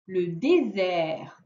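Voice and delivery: "Le désert" slow, leaning on the open è at the end; stretched final è. Shown as a demonstration. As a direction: draw out the ending of "désert". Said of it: "on entend à la fin le désert". (« désert » lent, è final appuyé)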